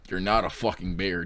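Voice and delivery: usual speaking voice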